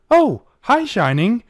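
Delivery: high, bright startled greeting; a mare surprised by her visitor; startled Trixie. Acted. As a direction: caught off guard, high and chirpy.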